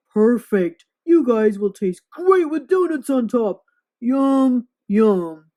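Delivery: loud hungry monster voice; greedy monster. Acted. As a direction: gloat over the meal. Monster relishing eating boys.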